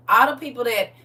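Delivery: usual speaking voice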